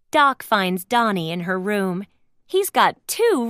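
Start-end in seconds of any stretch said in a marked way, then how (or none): none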